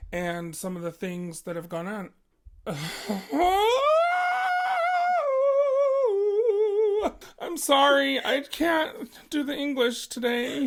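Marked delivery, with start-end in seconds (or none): 2.5-7.3 long shrieking possessed cackle, high and loud; possessed demonic laugh. Pretend. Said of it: "That was my possession voice". 7.4-10.7 high, loud possessed demon voice; possession voice. Pretend. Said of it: "That was my possession voice".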